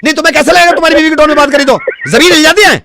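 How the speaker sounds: high-pitched mock outrage; indignant retort. Technician rejects customer's sorry, indignant rant. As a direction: throw the apology back heatedly.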